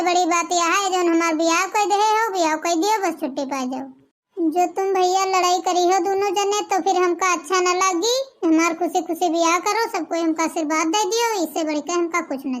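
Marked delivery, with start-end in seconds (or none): none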